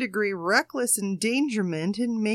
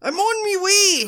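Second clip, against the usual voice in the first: put-on higher, louder English accent greeting; hammed-up English accent hello; mock English accent; 12 semitones higher, 8 dB louder than usual. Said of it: "Tell me that's your English accent".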